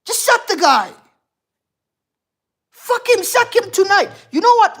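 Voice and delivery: loud, high-pitched outburst; heated, forceful demand; angry rant. Real, not acted. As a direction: vent real fury at a losing team's manager. Livid rant after team's defeat.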